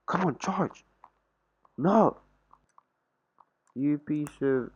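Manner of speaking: high, loud exasperated outburst; frustrated yell. Real, not acted. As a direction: yell at the dying laptop. Yelling at laptop not charging.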